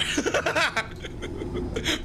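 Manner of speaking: breathless high cackle; high wheezy laugh. Real, not acted. Gamer laughing at own joke.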